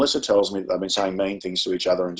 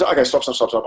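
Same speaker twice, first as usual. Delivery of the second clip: higher, insistent run of stops; urgent interruption; 5 semitones higher, 3 dB louder than usual. Retold or imitated. Teacher breaking up yelling pupils, retold urgent halt. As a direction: cut in to halt a rowdy class.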